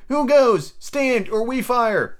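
high-pitched challenge called out; hailing. A narrator's reading. Captain challenging the buccaneer, hailing. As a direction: call a sharp challenge across a distance. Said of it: "Then he hailed the buccaneer".

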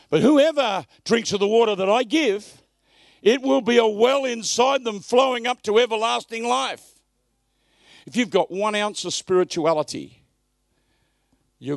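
loud, high, impassioned sermon delivery; fiery pulpit preaching; full-force preaching. Acted.

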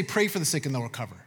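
quick, low, soft throwaway of "Pray for the sick"; mock wrong version. Preacher mocking the wrong verse, fast and low. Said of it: "Notice he didn't say".

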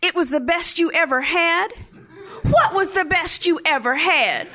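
high, loud imitation of an outraged mom; angry interrogation. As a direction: grill the kid, incredulous and furious. Angry mother interrogates over diary.

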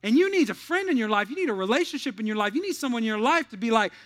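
loud high impassioned sermon; impassioned loud preacher; full-force preaching. Acted. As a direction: preach it hard to the crowd.

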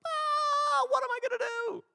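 high, loud panicked wail; panicked cry. Retold or imitated. Moses, trapped by Pharaoh's army, cries out in panic. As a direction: Moses panics with the sea behind him. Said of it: "and he's like".

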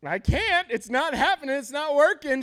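high, loud exasperated outburst. Acted. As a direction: vent at the end of your patience. Acts out a frustrated spouse's realization.

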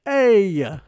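put-on loud, slow, high New York accent. Mocking New York accent to a friend about accents. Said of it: "the New York accent's just".

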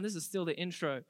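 ordinary talk, nothing marked

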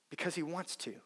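quiet repeat; soft echo. Shown as a demonstration. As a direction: drop to a near hush on the repeat. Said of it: "simply because he wants to".

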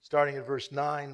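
usual speaking voice

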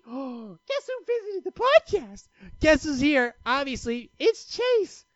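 high, loud gush over the visitor; excited announcement. Real, not acted. Podcaster announcing his dog has dropped in, excited. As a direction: announce a surprise guest in a high, thrilled voice.

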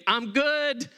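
a scared man begging them to stop, lightly played; imitating the paralyzed man crying out to be taken home. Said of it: "literally crying out".

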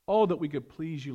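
usual speaking voice

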